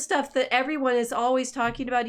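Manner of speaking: ordinary talk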